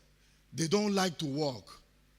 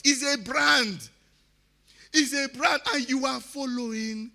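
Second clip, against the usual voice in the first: loud, higher shouted slogan; 9 semitones higher, 6 dB louder than usual. Real, not acted. Urging the South to change, real loud shout. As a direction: hammer the point home with a shout. Rallying speech to southern audience.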